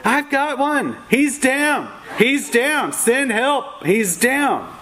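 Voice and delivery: high, urgent imitation of a panicked voice; panicked radio call. Volunteer reporting a fallen rider on her radio, high mock panic. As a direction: sound alarmed, calling for help. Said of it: "she was screaming into her radio".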